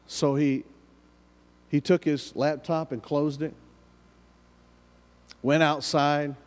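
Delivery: ordinary talk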